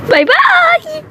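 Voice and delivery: squeaky high mocking bye-bye; teasing falsetto farewell; mock sing-song bye. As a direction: trill it high to tease.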